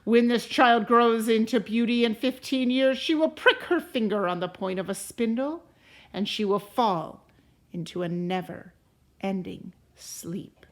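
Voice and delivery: loud threatening curse that sinks to a whisper at the ends; angry fairy's curse. Acted. Uninvited fairy cursing the king's baby, menacing and loud. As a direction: pronounce the doom, then hiss the last words. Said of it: "Then the angry fairy stood over the child, and she said".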